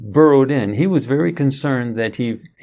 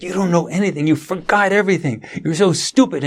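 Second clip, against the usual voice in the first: higher, scolding son's rant; imitating angry son; 7 semitones higher than usual. Son scolding his father in his head, mock angry and higher. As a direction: mimic the son's exasperated scolding. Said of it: "He was so upset".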